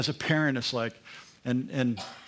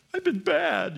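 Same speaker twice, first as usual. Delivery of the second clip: put-on much higher small voice of a guilty kid; childlike confession; 13 semitones higher, slower than usual. Preacher voices a child breaking and admitting guilt, small and high. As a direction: squeak it out like a caught child.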